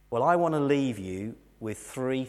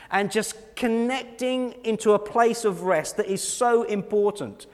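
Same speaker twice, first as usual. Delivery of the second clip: high-pitched, emphatic sermon delivery; raised preacher's voice, punching key words; impassioned preaching; 10 semitones higher, 6 dB louder than usual. Real, not acted. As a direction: drive the point home to the congregation. Preaches at full force.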